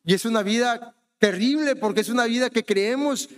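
ordinary delivery

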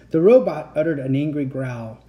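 usual speaking voice

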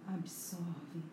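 whispering. Acted. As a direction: let the word fade into a whisper. Whispered close in meditation.

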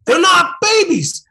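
high slow exasperated shout; exasperated yell. Real, not acted. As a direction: yell it, fed up. Players arguing loudly.

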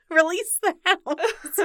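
slow words through laughter; laughing. Real, not acted. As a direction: laugh while reading it. Reading gif caption, laughing.

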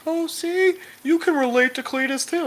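high-pitched mocking plea for sympathy; sarcastic, high-voiced pretend relating.

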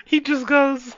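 high, laughing words; giggling through it. Real, not acted. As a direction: still wheezing from the joke. Reading chat, laughing.

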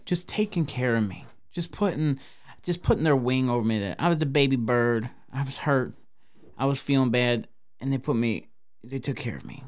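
soft and sincere. Real, not acted. Tender thanks to friends.

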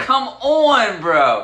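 high, loud come-on-bro plea; pleading with a suspect. Retold or imitated. Viewer groaning at a suspect onscreen, loud exasperated plea. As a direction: beg him to stop, loud and high. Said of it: "I'm like".

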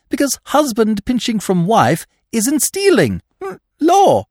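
put-on high, lively young gentleman; breezy, high-voiced persuader; eager young Freddy, scoffing. A narrator's reading. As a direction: pitch it high and excitable, brushing doubts aside.

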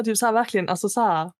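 ordinary talk

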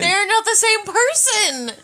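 loud protest. Real, not acted. Shouted back in the debate.

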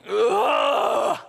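loud, high-pitched guttural groan of pain. Shown as a demonstration. Preacher demonstrating to the congregation what groaning sounds like, loud guttural groan. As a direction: let out one loud groan, like a body that hurts. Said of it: "deep, guttural groaning".

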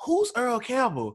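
high, slow innocent question; imitating clueless wife. Wife leaning in to ask husband, high and slow. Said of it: "she leans in and goes".

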